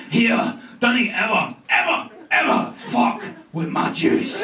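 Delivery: slow, menacing Scottish rasp; drawn-out Scottish warning with heavy pauses; scary Scottish accent. Retold or imitated. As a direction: imitate a Glaswegian threat, voice nearly gone. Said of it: "scariest words I've ever heard in a Scottish accent".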